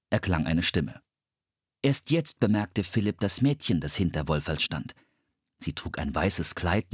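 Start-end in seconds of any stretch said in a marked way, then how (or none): none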